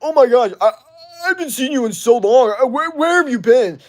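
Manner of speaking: high, loud, fake-teary gush of a long-lost fan; mocking gushing viewer. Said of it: "and they go".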